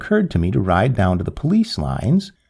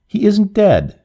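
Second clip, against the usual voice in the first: higher and louder, a cry of protest; raised, insistent outcry; 10 semitones higher, 4 dB louder, slower than usual. A narrator's reading. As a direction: fling the words out higher and louder. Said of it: "she cried again at last".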